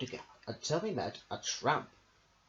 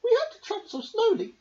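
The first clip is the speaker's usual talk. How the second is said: very high, loud fussing woman's voice; shrill high worried wife voice, near tears; fretting tinker's wife; 9 dB louder than usual. Acted. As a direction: fret and whine about the sick husband. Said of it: "asked the tinker's wife".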